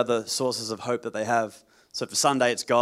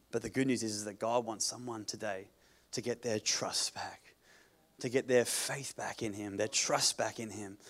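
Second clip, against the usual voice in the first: quiet, tender appeal; soft and sincere; 3 semitones lower, 8 dB quieter than usual. Acted. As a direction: gently draw them in.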